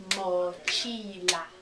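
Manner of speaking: very slow, stretched-out "Mochila"; syllable by syllable. Shown as a demonstration. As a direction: draw out "Mochila" as three syllables, mo-chi-la. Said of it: "Three syllables".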